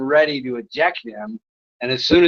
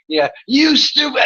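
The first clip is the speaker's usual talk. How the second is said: squeaky taunting troll, pitched way up; high mocking troll voice; 11 semitones higher than usual. Streamer mocking a chat troll, high jeer. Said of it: "He kept going".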